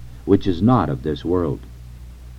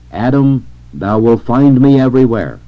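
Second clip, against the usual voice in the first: raised, solemn divine summons; calling out; 3 semitones higher, 5 dB louder than usual. A narrator's reading. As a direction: declare it as God confronting a sinner. God's voice calls to Adam.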